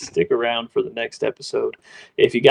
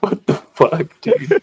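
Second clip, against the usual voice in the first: stunned disbelief; 6 semitones higher, 5 dB louder, slower than usual. Retold or imitated. Stunned by stranger's hug.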